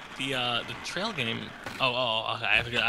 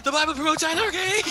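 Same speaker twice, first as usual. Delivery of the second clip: much higher, cracking up with glee; laughing excitement; 14 semitones higher, 4 dB louder than usual. Real, not acted. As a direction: blurt it out through laughter. Laughing mock revelation.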